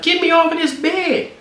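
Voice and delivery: high, slow mock screech; mock girlfriend demand. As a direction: mimic her demand in a high voice. Imitating girlfriend yelling.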